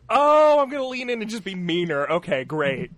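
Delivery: loud high "Oh", easing down; hammed-up dawning realization; mock resolve. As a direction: act out deciding to take on the hecklers. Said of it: "she was like".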